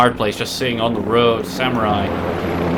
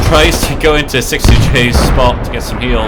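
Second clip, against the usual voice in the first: loud, building play-by-play; excited commentary; 10 dB louder than usual. Real, not acted. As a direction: ramp up the call as it lands. Caster builds with artillery hits.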